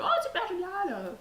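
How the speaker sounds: high, delighted exclamation; delighted monk. Acted. Monk thrilled to travel to Aquitaine, high delighted squeal. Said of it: "il est tout content, il fait".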